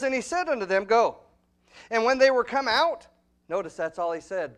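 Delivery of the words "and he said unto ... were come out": thundering Bible reading; preaching scripture. Acted. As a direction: proclaim the verses from the pulpit. Preacher reads verse loudly.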